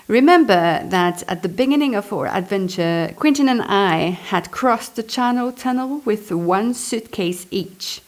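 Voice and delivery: ordinary talk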